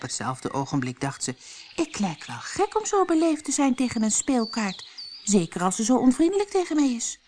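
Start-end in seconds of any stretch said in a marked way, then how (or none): 1.8-7.2 lightly voiced girl, quick inner grumble; a girl muttering to herself, fast; Alice thinking. Alice thinks she is too polite to a playing card, lightly voiced and quick.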